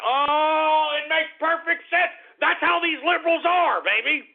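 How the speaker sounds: loud, high, furious rant; angry shout. Real, not acted. Ranting at liberals in a loud, high shout. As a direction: yell it at the whole room in disgust.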